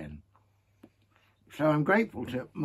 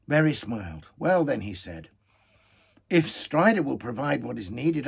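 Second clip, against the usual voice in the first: louder, lively reading of a tale; story narration with voiced characters; 7 semitones higher, 9 dB louder than usual. A narrator's reading. Fireside reader to his listeners, animated storytelling. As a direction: read the tale aloud with gusto. Reading Tolkien aloud, hobbits and Aragorn.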